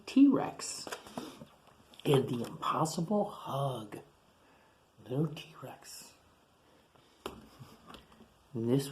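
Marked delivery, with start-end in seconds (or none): 0.1-0.9 breathy dramatic whisper of the name; whispered title. Acted. Teacher whispers the title to the class. 5.1-6.0 soft whispered name. Acted. Teacher whispers to the class about the toy.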